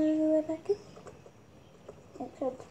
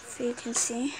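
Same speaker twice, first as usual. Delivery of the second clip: whispering; 5 semitones lower, 5 dB quieter than usual. Real, not acted. Whispered aside.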